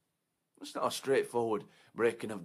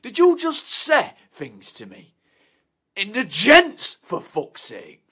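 loud, higher, slowing on the end; outraged shout; 10 semitones higher, 19 dB louder, slower than usual. Acted. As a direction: blow up at him. Outraged confrontation in toilet.

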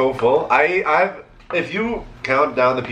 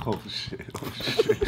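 whispered; 3 semitones lower, 11 dB quieter, slower than usual. Real, not acted. Whispering during ASMR bit.

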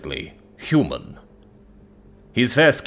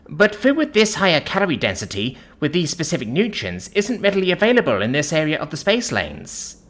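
high-voiced, servile explanation; grovelling; 7 semitones higher than usual. A narrator's reading. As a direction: explain humbly to an angry customer, pitched high. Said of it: "she grovels".

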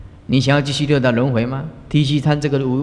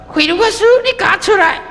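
high, loud mock yell calling someone out; angry shout; 5 dB louder than usual. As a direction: shout them out of the house, hamming it up. 家人追来喊人回家. (高声大喊，模仿家人气急地叫人出来)

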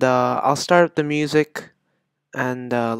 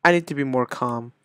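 ordinary talk, nothing marked

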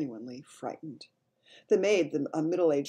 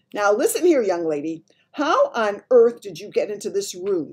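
loud, high-pitched telling-off; furious shout; 5 semitones higher, 11 dB louder than usual. A narrator's reading. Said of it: "the maid shouted furiously".

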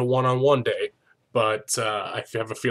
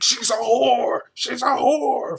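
high, loud mock shriek; hammed-up hysterical yelling, words stretched; flying off handle; 13 semitones higher, 8 dB louder, slower than usual. Host imitates contestant insulting Claire, flying off the handle. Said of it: "Flies off the handle".

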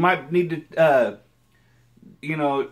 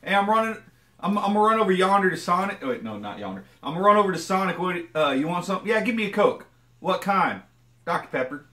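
higher, brighter mock Okie chatter; Oklahoma twang; 5 semitones higher, faster than usual. Showing a common Oklahoma chat, mock Okie twang. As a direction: play two Oklahomans trading lines. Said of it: "a common conversation here in Oklahoma".

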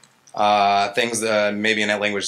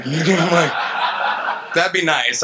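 laughing; 7 semitones higher, 4 dB louder, much slower than usual. Real, not acted. Wistful joke about compiling code.

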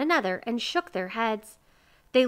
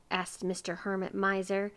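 usual speaking voice

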